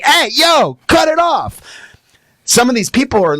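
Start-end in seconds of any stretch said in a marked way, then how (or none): 0.0-1.6 high shouted command to a dog; sharp scolding. Acted. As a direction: call out sharply to stop the dog. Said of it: "think they're abusive ... by saying".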